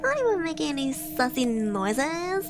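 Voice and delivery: put-on playful Valley Girl accent; ditzy Valley Girl twang. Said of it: "I'm just doing a Valley Girl accent".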